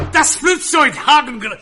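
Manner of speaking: high, shouted mock German; aggressive German. Comic imitating an unromantic lover, aggressive shouted German. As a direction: yell it in a fierce German accent. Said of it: "fucking aggressive".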